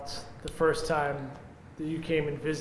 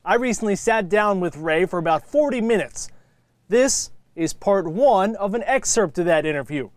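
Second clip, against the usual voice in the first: measured, polished TV sports feature narration; broadcast presenter read; 4 semitones higher, 6 dB louder than usual. Real, not acted. Host introducing Fresno FC to viewers, scripted presenter read.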